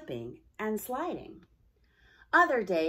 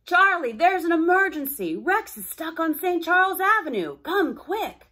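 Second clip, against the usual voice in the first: high, loud dispatcher voice; a radio voice calling Charlie to an emergency; urgent radio call; 9 semitones higher, 10 dB louder than usual. Acted. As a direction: call it out fast and alarmed. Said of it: "a call came in over the radio".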